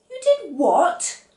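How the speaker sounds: high, slow, loud "you did what"; shocked disbelief. A narrator's reading. Wife stunned at her husband's rash promise, shocked.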